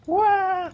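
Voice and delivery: very high-pitched mock "waah"; imitated freak-out wail. Commentator mimicking a player who freaked out, high-pitched mock wail. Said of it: "Milo just went".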